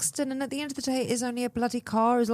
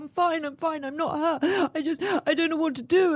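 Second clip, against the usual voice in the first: high, tearful panicked voice; upset young driver; 9 semitones higher than usual. Retold or imitated. Crying young driver apologising after crash, high and panicky. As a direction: voice her rushing out sorry in shock, pitched high. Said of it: "She's like".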